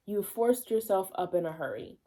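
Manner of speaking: ordinary delivery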